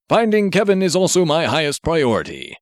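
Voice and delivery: a hint of a stiff formal hero voice; lightly voiced superhero. Grenade Man instructing Marv from the robot, lightly heroic voice.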